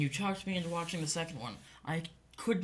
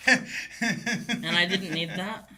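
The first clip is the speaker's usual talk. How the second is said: real laughter, stretched slowly through the words; laughing; 5 semitones higher, 7 dB louder, much slower than usual. Real, not acted. Guest laughing while admitting what bothered him, laughing. Laughing over the penis shot.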